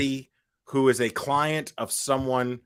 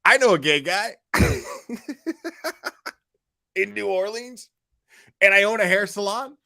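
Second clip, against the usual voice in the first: mocking quote; 9 semitones higher, 3 dB louder than usual.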